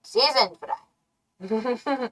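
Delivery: slow, soft, dreamy savoring. Acted. Joking about fries, slow dreamy savoring. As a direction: drawl it out as if tasting.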